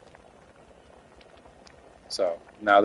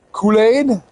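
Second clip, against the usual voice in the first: incredulous; 10 semitones higher, 5 dB louder than usual. Real, not acted. Incredulous reply.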